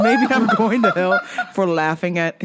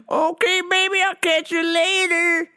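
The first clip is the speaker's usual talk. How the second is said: granny voice; 10 semitones higher than usual. Retold or imitated. Voicing Weed Granny leaving.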